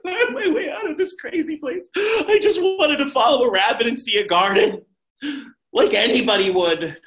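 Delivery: Alice lost in the wood, laughing it off. Acted. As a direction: giggle through it, half mad. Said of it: "she's like".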